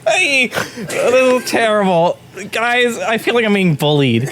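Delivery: high, whiny laughing complaint; laughing whine. Real, not acted. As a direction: whine through giggles.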